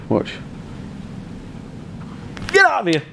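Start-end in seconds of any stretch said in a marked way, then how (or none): 2.5-3.0 a sudden high, loud mock yell; mock shout. Owner wrestling the dog, sudden mock yell. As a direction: yelp it out in playful alarm.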